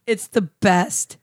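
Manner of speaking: slow. Real, not acted. Drawn-out cheese praise.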